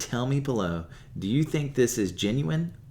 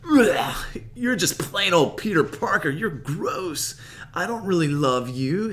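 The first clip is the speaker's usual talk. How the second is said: higher, louder, whiny crying tone; 8 semitones higher, 5 dB louder than usual. Retold or imitated. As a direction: sneer through a fake sob. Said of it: "And she's like".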